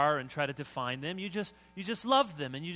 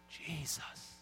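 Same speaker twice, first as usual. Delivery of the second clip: hushed, drawn-out reverent "Jesus"; super spiritual; 9 dB quieter than usual. Shown as a demonstration. Preacher showing how most hear the name, super spiritual. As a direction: breathe the name slowly, as something holy. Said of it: "super spiritual name".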